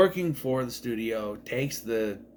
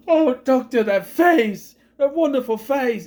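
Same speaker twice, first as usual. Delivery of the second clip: put-on high, louder, choked-up old man's praise; tearful; 11 semitones higher, 8 dB louder than usual. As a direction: speak through imitated tears of joy. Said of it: "he was crying".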